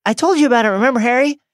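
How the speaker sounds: high, eager character voice; voiced Luna. Luna reminding Harry about the lost diadem, in a high character voice. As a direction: keep it pitched right up, like an excited book character. Reader plays Luna from the story.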